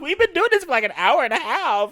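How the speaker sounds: high, loud laughing disbelief. Real, not acted. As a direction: shriek it out through laughter. Laughing shock at the long recording.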